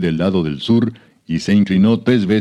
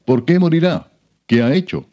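much higher-pitched, pleading protest; protesting question; 9 semitones higher than usual. A narrator's reading. Jonatán protesta ante su padre. (pregunta de protesta, en tono agudo)

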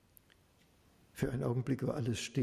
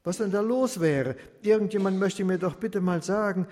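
raised, louder pleading to the crowd; urgent calling out; 5 semitones higher, 6 dB louder than usual. Acted. Blind beggar begging the crowd for an answer, urgent call. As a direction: call out eagerly, straining to be heard.

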